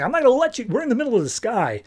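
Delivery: high-pitched mock protest; alarmed protest. Refusing to drop a friend mid-flight, alarmed protest.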